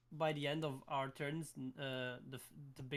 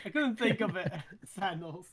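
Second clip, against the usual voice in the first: laughing at himself; giggling through the words; 3 semitones higher, 9 dB louder than usual. Real, not acted. Laughs at forgetting the word.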